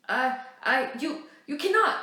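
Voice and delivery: high loud stammering outburst; indignant exclaim. Acted. Major Webb losing his words at Riel, loud sputtering outrage. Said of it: "exclaimed Major Webb".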